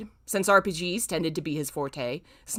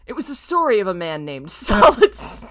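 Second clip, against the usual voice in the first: laughing; 9 dB louder than usual. Real, not acted. Laughing restart.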